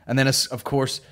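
usual speaking voice

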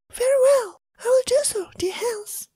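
high, half-whispered reply; meek Elsa. Acted. Elsa answers husband meekly.

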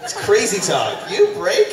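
mock astonishment. Sarcastic mock disbelief at partner.

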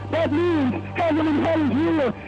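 normal talking voice

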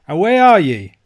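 loud, high-pitched angry demand; irate Scots uncle shouting into the dark. A narrator's reading. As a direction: call it out crossly, voice jumping up. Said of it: "says my uncle angrily".

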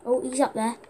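ordinary delivery

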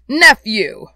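sharp admonishing call; stern. A narrator's reading. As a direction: cut him off forcefully. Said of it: "returned the uncle sternly".

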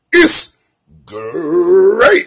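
loud, stretched cereal-mascot roar; Tony the Tiger. Retold or imitated. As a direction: belt it like the tiger in the ad. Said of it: "In the words of ... Tony the Tiger".

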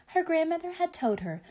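quick, lifted refrain; brisk refrain. A narrator's reading. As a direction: rattle off the familiar refrain. Repeated refrain read to children.

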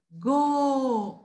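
"Go" drawn out on a round O; teacher sounding out "Go" slowly; syllable demo. Shown as a demonstration. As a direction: hold the O of "Go". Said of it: "Olha o O lá na boca".

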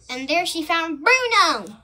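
big storybook reveal; excited reveal. A narrator's reading. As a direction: announce the discovery with a thrill.

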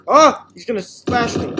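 loud high alarmed yell; panicked cry. Real, not acted. Player sees enemy coming and yells in panic.

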